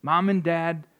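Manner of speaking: ordinary delivery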